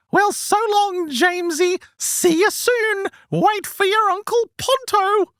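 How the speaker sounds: very high, sing-song eunuch voice. Acted. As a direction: squeeze it up into a strange, high, sing-song creature voice. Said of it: "the voice again says".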